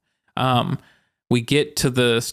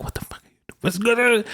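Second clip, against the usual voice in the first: covert whisper; 9 semitones higher than usual. Retold or imitated. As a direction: whisper it so the partner can't hear. Mouthing secretly at friend, retold.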